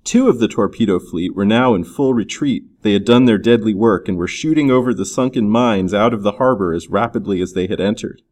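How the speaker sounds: ordinary delivery, nothing marked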